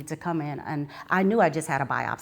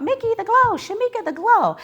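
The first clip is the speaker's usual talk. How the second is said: gushing praise; 7 dB louder than usual. Retold or imitated. People praising her glowing sister.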